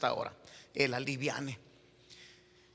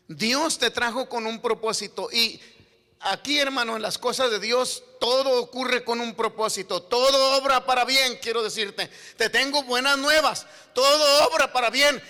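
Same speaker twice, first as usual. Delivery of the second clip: raised pitch, growing louder phrase by phrase; emphatic preaching; 7 semitones higher, 8 dB louder than usual. Real, not acted. Preacher assuring the congregation of God's purpose, emphatic rising preaching. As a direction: build up the promise with fervour.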